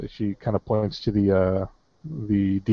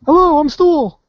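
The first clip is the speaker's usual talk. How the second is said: very high, louder mushroom creature voice; Stool; 6 dB louder than usual. Acted. Mushroom creature introducing itself telepathically, high piping voice. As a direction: pipe up high and loud in greeting. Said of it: "a voice in your head".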